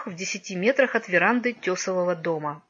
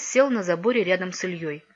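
usual speaking voice

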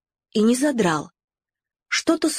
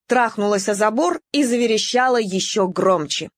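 ordinary delivery, nothing marked

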